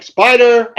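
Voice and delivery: high, loud call for the boss. Retold or imitated. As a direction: holler the name as if summoning help. Retells calling the boss over.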